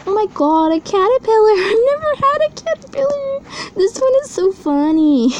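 high, giggly shriek of delight; thrilled squeal. Real, not acted. Collector finding a first ever caterpillar, thrilled squeal.

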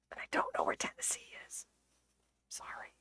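tiny, sheepish whispered admission; apologetic whisper. Real, not acted. Streamer apologising to Americans for bad geography, sheepish whisper. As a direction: whisper it as a guilty confession. Apologising for not knowing the states.

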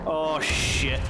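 sudden alarmed shout; startled shout. Real, not acted. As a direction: blurt it out in alarm. Sudden danger in game.

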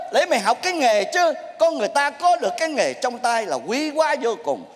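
ordinary talk

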